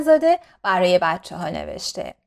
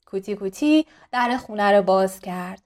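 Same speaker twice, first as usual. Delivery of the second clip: ordinary delivery, nothing marked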